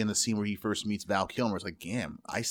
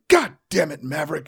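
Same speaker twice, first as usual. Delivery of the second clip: gruff commander yell; 6 semitones higher, 9 dB louder than usual. Retold or imitated. Quoting Stinger yelling at Maverick.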